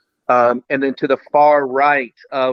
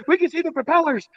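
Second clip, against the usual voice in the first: much higher, excited mock gush; excited Dave; faster than usual. Friend amazed at seeing the propellers, high excited gush. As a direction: squeal it like a thrilled friend. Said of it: "but he goes".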